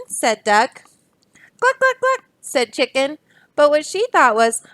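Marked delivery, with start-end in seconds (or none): 1.6-2.3 high, clucking hen. Acted. Chicken answers Duck with high clucks.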